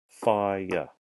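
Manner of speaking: "Fire" stretched into two syllables; slowly. Shown as a demonstration. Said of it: "let's say it slowly".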